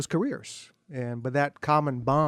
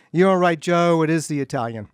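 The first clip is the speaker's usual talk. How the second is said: much louder mock call across a restaurant; retold yell to husband; 3 semitones higher, 9 dB louder than usual. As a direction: shout it over the tables, certain. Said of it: "yelled across the restaurant to her husband again".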